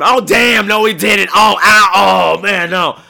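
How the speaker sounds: loud rowdy yelling, high; yelling at the screen. Retold or imitated. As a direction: shout back at the movie screen. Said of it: "people yelling back at the screen".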